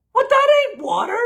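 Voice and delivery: high, loud disbelieving retort; incredulous outburst. Retold or imitated. Doctor scoffing at patient's watermelon excuse, high and loud. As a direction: jump up in mock disbelief.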